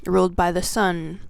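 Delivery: ordinary delivery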